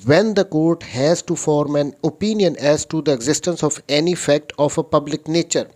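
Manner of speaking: slow, deliberate reading of the law; reading statute aloud. Shown as a demonstration. Lecturer reads the article to his students, slow and deliberate. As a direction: read every word out like a legal text.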